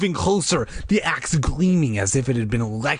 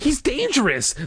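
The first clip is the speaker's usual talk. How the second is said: exclaiming; 13 semitones higher than usual. Acted.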